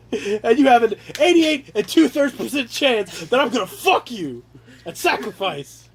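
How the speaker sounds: hammed-up boastful threat; mock tough-guy odds speech. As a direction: taunt him with fake statistics.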